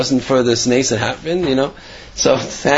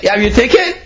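higher, pressing repeat of the question; insistent repeat; 8 semitones higher than usual. Acted. Friend at the train pressing a second time, higher and insistent. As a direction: push him for an answer.

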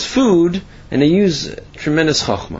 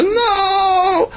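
high anguished shriek; horrified cry. Acted. Tenants seeing two more carcasses, crying out in horror. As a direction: cry out in dismay.

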